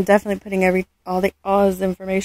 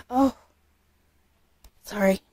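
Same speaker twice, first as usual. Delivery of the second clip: ordinary delivery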